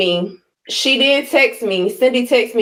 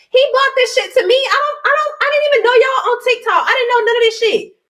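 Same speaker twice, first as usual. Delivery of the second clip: much higher, wide-eyed disbelief; retold surprise; 12 semitones higher than usual. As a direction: act out being caught off guard. Retelling her own surprise.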